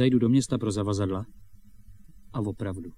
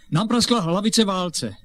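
blurted excuse; 7 semitones higher, 4 dB louder than usual. Acted. Boy blurts out sudden invented excuse.

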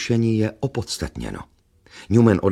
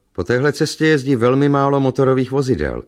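lightly voiced inspector, pitched higher; higher, emphatic character voice; inspector explaining; 6 semitones higher, 4 dB louder than usual. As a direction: make the point in a raised voice.

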